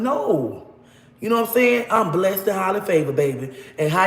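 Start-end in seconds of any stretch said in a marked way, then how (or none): none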